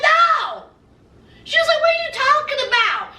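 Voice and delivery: high mock indignant squeal. Bride refusing her groom, high indignant mock voice. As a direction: she shoots him down in disbelief.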